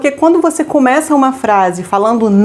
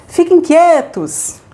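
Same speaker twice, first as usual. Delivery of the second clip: very slow "Fiquem quietos", a bit higher; slow calm command; 6 semitones higher, much slower than usual. Shown as a demonstration. Parent to children, slow firm command. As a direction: stretch it out gently but firm. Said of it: "como que você poderia falar pro seu filho".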